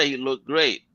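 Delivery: ordinary talk, nothing marked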